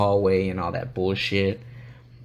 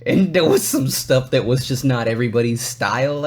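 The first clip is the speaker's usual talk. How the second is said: usual speaking voice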